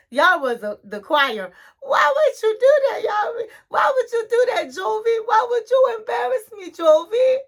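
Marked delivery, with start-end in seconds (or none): 1.8-7.4 high-pitched, wailing mock complaint; hammed-up high whine of protest; upset wife. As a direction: imitate a hurt wife scolding her husband. Retold wife scolding her husband.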